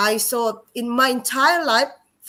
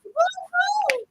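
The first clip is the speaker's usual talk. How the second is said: scared cry. Retold or imitated. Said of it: "I was so scared".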